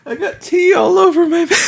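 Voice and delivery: shrieking with laughter, high and breaking; laughing hard. Real, not acted. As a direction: blurt it out mid-laugh, barely holding it together. Cracking up after a funny meme.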